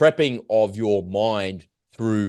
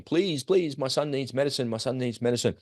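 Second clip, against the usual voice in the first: a touch of a higher, softer begging voice; pleading father; 3 semitones higher, 5 dB quieter than usual. Retold or imitated. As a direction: lightly act a father begging for medicine. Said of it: "he's pleading with him".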